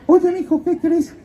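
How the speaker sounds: very high godmother caricature. Retold or imitated. Godmother phoning her godson with news, in a very high put-on voice. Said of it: "me habla y me dice".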